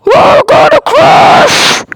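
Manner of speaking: very high, loud, slow scream; panicked scream. Acted. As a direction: scream in terror of crashing. Panicked in elevator.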